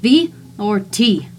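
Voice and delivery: loud, slow call through the car window; shouting. Acted. Narrator shouts at his two boys through the window. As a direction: bark the question at the boys. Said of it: "I shouted to the boys".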